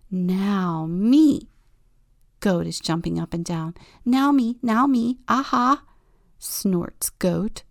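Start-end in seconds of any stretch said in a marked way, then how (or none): 0.1-5.8 loud bouncing excited calls; eager. Acted. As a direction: jump up and down shouting. Goat jumping eager.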